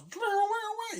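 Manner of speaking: very high wobbly "dwah" noises; loud vocalised sound effect; imitated game jingle. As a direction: mimic a cartoon power-up sound.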